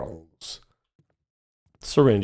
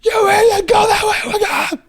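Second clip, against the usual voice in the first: exasperated viewer. Retold or imitated. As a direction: play the frustrated chat screaming at the screen. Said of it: "the dev and everyone watching saying".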